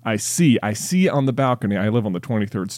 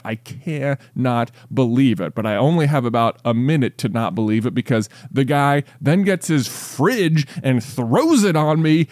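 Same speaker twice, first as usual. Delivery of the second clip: ordinary talk, nothing marked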